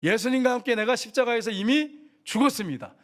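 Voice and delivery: high-pitched, emphatic confession of faith; declaration. Shown as a demonstration. Pastor teaching the congregation what to say when angry, emphatic declaration. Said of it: "이렇게 선포하셔야 됩니다".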